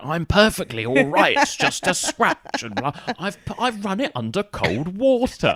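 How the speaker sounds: high, slow, breezy dismissal of a bite; stiff upper lip dad. Retold or imitated. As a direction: brush it off like an unflappable English dad. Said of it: "And he's like".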